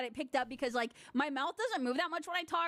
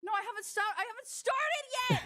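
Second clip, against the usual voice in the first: high protest; 9 semitones higher than usual. Acted.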